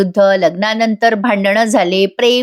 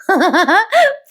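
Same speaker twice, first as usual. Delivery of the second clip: high rippling giggle; amused laugh; 10 semitones higher than usual. Real, not acted. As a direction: break into a short real laugh. निवेदिका स्वतः हसते. (उंच स्वरातलं हसू)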